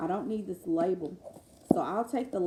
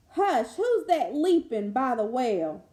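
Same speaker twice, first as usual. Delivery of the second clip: storybook reading; 4 semitones higher, 9 dB louder, slower than usual. Acted. Reading the book aloud.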